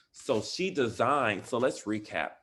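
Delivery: ordinary talk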